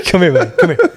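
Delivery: high-pitched, giggly calling to a pet; laughing dog call. Real, not acted. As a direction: call the dog over through real laughter.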